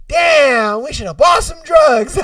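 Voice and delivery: loud, high comic outburst; shouted mock regret. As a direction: yell the punchline in exaggerated regret. Acted punchline, staff regret.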